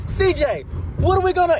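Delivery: high, loud alarmed call; calling to his brother as chaos breaks out; panicked call. Retold or imitated. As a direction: shout across the room in panic.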